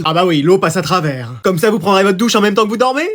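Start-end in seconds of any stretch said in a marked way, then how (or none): none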